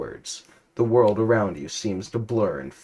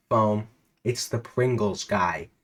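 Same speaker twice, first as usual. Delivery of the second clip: usual speaking voice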